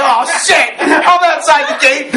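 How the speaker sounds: alarmed. Retold or imitated. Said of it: "I'm like".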